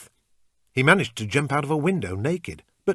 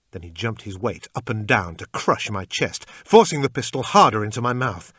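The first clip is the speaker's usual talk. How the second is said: plain reading voice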